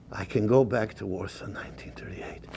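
breathed, voiceless whisper of disbelief; awed whisper. Acted. Old survivor dreaming of Warsaw, whispering in awe. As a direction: hush it like a secret too big to say.

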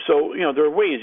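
normal talking voice

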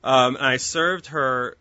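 ordinary talk, nothing marked

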